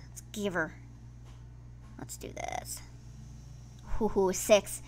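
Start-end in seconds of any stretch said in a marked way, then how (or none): 0.1-2.8 soft unvoiced whisper; whispered psych-up. Real, not acted. Player whispers to herself before spinning. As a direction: whisper it to yourself before the spin.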